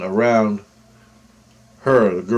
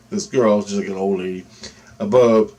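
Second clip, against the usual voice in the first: normal talking voice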